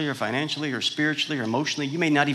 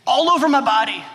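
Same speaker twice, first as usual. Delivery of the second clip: high, loud whiny patient voice; a sick man whining to his doctor; 10 semitones higher, 8 dB louder than usual. Acted. Patient complains to doctor, high whiny voice.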